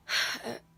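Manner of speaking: short, loud exhale; tired sigh. Real, not acted. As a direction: puff out a heavy breath. Tired from holding the camera.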